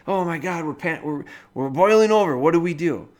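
rising panicked cry, loud at the peak; mock panic. Cook panicking as the pot boils over, high and loud.

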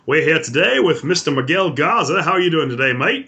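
high, put-on Australian greeting; hammed-up Aussie welcome; Australian accent. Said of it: "not doing the Australian accent".

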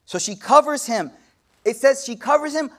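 usual speaking voice

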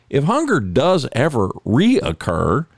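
usual speaking voice

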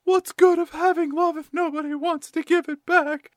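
mock mopey high-pitched whine; Crystal. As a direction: play a weepy girl, high and self-pitying. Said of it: "Crystal is crying and moping".

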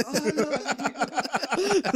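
high, laughing "oh no"; hammed-up shock while cracking up; mock dismay. Retold movie characters' shocked reaction.

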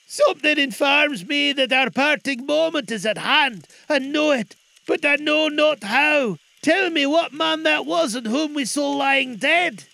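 high, loud, pleading character voice; anxious Scrooge. Acted. Scrooge begging the spirit for an answer, anxious.

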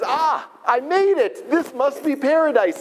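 thrilled traveller who thinks he has made it; high, overjoyed cry of arrival; excited. Retold or imitated. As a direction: voice jumps high with the joy of arriving. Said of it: "all excited, overjoyed".

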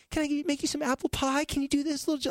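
high, pleading mom impression; needy, desperate mom. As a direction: plead like an over-eager, smothering mom. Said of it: "the needy, desperate mom".

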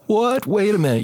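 high-pitched startled "what", retold; incredulous. Pilot hears they will fell the tree, incredulous protest. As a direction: act out stunned protest, voice jumping up. Retold shock at chainsaw plan.

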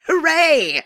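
loud, high mock hooray; sarcastic cheer.

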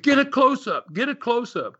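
urgent high order, a mock scream; retold yell. Promoter to his frozen director, urgent high shout. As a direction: bark the order, pitched high. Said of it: "I had to scream at him".

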